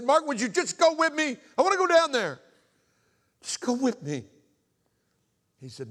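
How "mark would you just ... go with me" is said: high, loud retold begging; desperate plea. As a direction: beg your friend at the top of your voice. Acts out desperate plea to friend.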